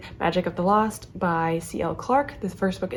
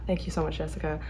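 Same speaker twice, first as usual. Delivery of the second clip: choked up; 3 semitones lower, 7 dB quieter than usual. Real, not acted. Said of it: "almost making me cry".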